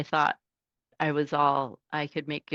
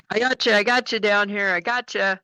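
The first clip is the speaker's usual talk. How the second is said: higher, loud cheery CB greeting; trucker on the radio; 7 semitones higher, 4 dB louder than usual. Retold or imitated. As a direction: boom a friendly hello over the radio. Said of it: "he would say".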